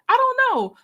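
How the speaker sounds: a squealed comic exasperation; high loud mock despair; exasperated. Real, not acted. Repeating, more exasperated.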